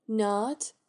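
ordinary delivery

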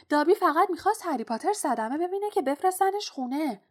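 high, loud, urgent elf voice; high-pitched, eager creature voice; house-elf Dobby, pleading. A narrator's reading. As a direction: protest like a devoted servant elf.

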